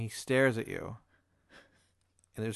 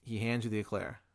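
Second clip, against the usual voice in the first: ordinary talk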